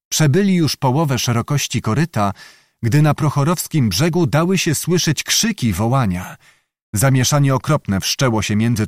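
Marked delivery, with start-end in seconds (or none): none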